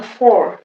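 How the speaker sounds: English "four", open aw vowel; English pronunciation. Shown as a demonstration. English word contrasted with French four.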